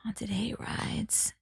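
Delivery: slow, spooky whisper. Acted. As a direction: breathe it out like a ghost story.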